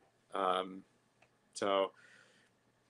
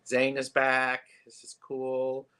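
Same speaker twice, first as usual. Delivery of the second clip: ordinary delivery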